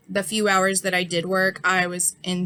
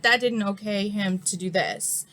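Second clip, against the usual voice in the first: normal talking voice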